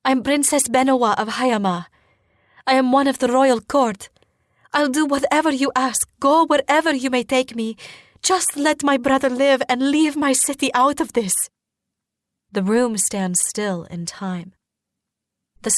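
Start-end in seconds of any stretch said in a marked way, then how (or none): 0.1-11.5 high, insistent plea; urgent pleading. A narrator's reading. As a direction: bargain desperately in a raised pitch. Princess pleads for brother's life.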